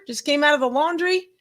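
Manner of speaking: ordinary talk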